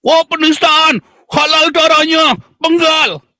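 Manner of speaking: high, strained cry of mock offence; mock outraged zealot. Opponents accuse him of blasphemy, high mock outrage. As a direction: parody an indignant accuser in a high voice.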